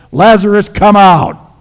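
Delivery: high, booming command; loud calling out. Acted. Jesus calls the dead man from the tomb, loud calling out. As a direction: shout it to reach inside a tomb. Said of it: "calls out in a loud voice".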